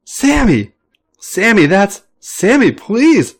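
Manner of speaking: very high tickled shriek of his name. A narrator's reading. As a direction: squeal and beg while being tickled. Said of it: "Finn shrieks".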